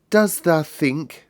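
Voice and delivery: dreamy carefulness. Acted. Colin wonders aloud to his friends, dreamy. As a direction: ask it slowly, dreamily. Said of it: "with dreamy carefulness".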